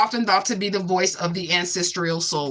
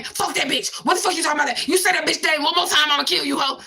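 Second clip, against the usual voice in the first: raised-pitch mimic of an angry threat; a hint of a furious enemy, pitched up; mock threatening rant; 5 semitones higher than usual. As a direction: play the hothead spitting threats, hammed-up.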